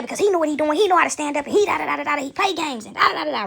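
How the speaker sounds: mimicked frustrated rant; frustrated coworker. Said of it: "she was more frustrated than normal".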